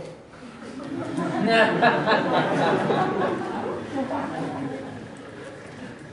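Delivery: long hearty real laugh. Real, not acted. Storyteller amused by the dog's film role, laughing. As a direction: laugh at length after your punchline.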